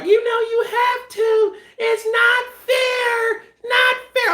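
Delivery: put-on very high, drawn-out child wail; mock-plead like a small niece, stretching the words; hammed-up squealing little-kid complaint; little girl's protest. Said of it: "Little curly head, his niece, was like".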